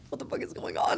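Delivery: half-whispered startled driver; a hushed, stunned question; imitating the second driver. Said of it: "he was like".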